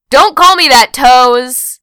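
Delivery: loud, high mock-offended retort; high, loud comeback; indignant shout. A narrator's reading. Acted retort to a teasing nickname.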